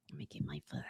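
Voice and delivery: unvoiced whispered aside; whispering. Real, not acted. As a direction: whisper it to yourself. Aside while fetching a flashlight.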